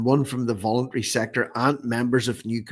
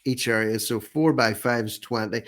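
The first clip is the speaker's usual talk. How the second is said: normal talking voice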